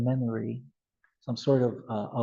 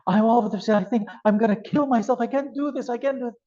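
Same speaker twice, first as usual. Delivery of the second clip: panicked client; 8 semitones higher, 3 dB louder, faster than usual. Retold or imitated. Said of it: "People come and say".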